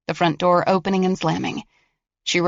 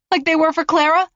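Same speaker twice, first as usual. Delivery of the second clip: high, sharp accusing question; defiant girl; 10 semitones higher, 4 dB louder than usual. Acted. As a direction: throw it back at him. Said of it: "she asked".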